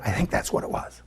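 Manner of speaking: soft unvoiced whisper. Waitress whispering her guess to the pastor. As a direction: breathe it out secretly. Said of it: "she got really quiet".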